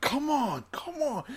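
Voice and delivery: very high, straining cry at ordinary volume; impassioned wrestler. Retold or imitated. Co-host re-enacting the wrestler standing up to the kicks, high impassioned cry. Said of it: "the passion, uh, in him".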